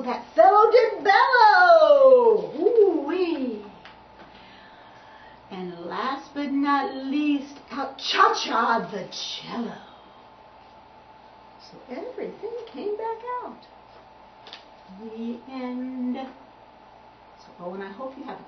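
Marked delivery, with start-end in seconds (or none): 0.0-2.5 slow, loud, higher drawn-out bellow; hammed-up bellow. Story climax, fellow bellows. 2.6-16.2 put-on lively singsong read-aloud for little kids; reading to children. Reads picture book to children.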